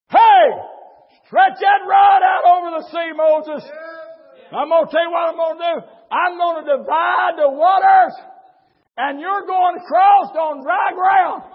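fiery pulpit shouting; full-force preaching. Real, not acted.

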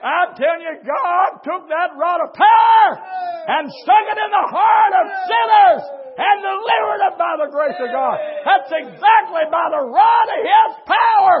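fiery pulpit shouting; full-force preaching. Real, not acted.

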